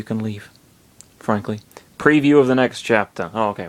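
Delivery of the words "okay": ordinary delivery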